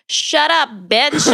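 high, playful telling-off; mock retort. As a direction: pretend comeback delivered for a laugh. Imagined comeback, then says just kidding.

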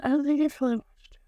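whispered admission. Real, not acted. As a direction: say it under your breath. Muttered admission.

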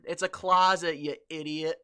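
loud, emphatic correction; mock exasperation. Podcaster scolds imaginary Brit over wardrobe, mock exasperation. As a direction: correct it loudly, hitting closet.

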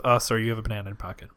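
quick, quiet read; hurried, low-key cue; rapid line prompt. Shown as a demonstration. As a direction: rattle it off softly.

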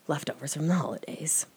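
breathy joking whisper, as a secret; hushed aside about the leftovers; mock whisper. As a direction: whisper it like a naughty confession. Joking confession, then "I'm kidding.

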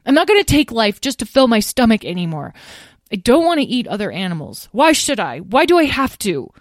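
high, loud, impassioned protest; distressed young dragon. Acted. Azrael refusing to eat other animals, impassioned. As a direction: plead the case heatedly to Mom. Azrael argues passionately with mother.

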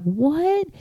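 softly voiced, high rising astonishment; retold disbelief. Recalling the disconnect notice, baffled. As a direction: let it leap up quietly, incredulous.

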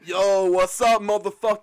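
loud swaggering frat-bro greeting; mock bro king. King Eric enters in baseball cap, mock bro. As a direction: hail the crowd like a cartoon bro.

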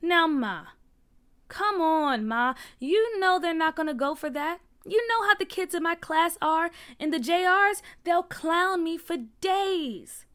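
high, loud pleading with mother; whining protest. A narrator's reading.

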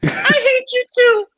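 very high, slow and sneering; demon voice. Acted. Demon answering Barrett, high and slow. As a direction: drag out the hate in a squeal. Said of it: "your high pitched screaming voice".

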